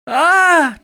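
high, loud scream; battle scream. A narrator's reading. Chance sawing away at the creature, screaming. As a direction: let out a piercing yell. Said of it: "Chance screamed as he sawed away".

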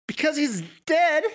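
very high, loud hysterical outburst; manic snickering. A narrator's reading. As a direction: blurt it out as laughter takes over. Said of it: "Maddie snickered".

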